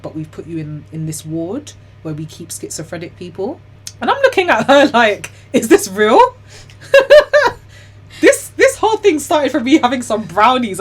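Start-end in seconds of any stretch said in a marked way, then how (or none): 4.0-7.1 loud, high, incredulous laughing delivery; laughing disbelief. Retold or imitated. Laughing disbelief at ward placement. 8.2-10.8 loud, high, just out of a laughing fit; incredulous amusement. Real, not acted. Laughing at absurd outcome.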